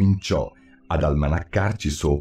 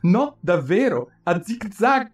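put-on much higher, precise little girl; fussy, exact girl, pitched way up; prim Alice; 13 semitones higher than usual. A narrator's reading. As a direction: correct him primly as Alice. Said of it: "precisina come sempre".

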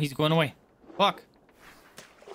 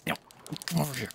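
normal talking voice

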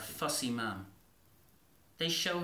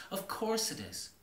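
usual speaking voice

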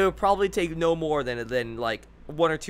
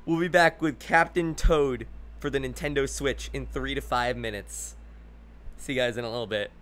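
ordinary talk, nothing marked

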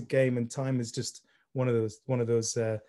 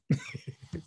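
short, quiet chuckle before speaking; stifled anticipatory chuckle; real laugh; 5 semitones higher, 6 dB quieter than usual. Real, not acted. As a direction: laugh softly before getting to the point. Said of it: "I'm laughing before I say it".

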